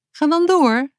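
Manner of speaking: high, impatient prod. A narrator's reading. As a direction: urge him on impatiently. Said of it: "zei ze ongeduldig".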